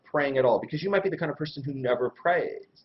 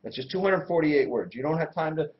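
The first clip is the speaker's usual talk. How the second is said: normal talking voice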